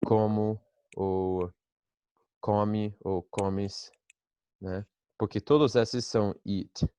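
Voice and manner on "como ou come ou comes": very slow careful listing of forms; slow and deliberate. Shown as a demonstration. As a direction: drag out each form for the class. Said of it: "esclarecido para significar comer e não". (listando devagar, bem pausado)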